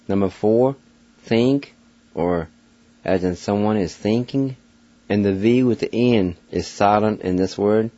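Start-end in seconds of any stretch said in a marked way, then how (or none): none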